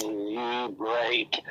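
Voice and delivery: low whispered repeat; creepy whispered echo. Retold or imitated. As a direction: whisper it back, chilling. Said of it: "when all of them say".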